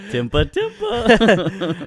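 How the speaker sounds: loud laughter. Real, not acted. Co-host laughing hard.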